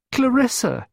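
very high, loud wail; high-pitched distressed cry. A narrator's reading. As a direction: wail for help like a distraught child. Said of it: "she wailed".

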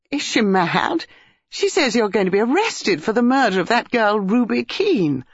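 high, agitated burst of words; breathless outburst. A narrator's reading. Dinah tells Basil of the accusation, breathless outburst. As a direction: burst out with the alarming news. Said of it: "Dinah burst out breathlessly".

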